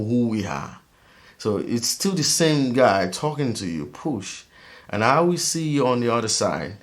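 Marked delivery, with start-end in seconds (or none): none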